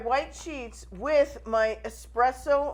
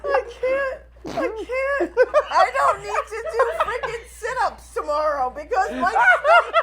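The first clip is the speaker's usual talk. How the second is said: much higher, laugh-crying; helpless laughter; 11 semitones higher, 5 dB louder than usual. Real, not acted. Telling her co-host her stomach hurts from laughing, laugh-crying. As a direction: gasp it out, aching from laughing.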